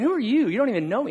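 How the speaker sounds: high-pitched, affronted disbelief; indignant inner retort. Retold or imitated. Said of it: "in my head, I'm thinking".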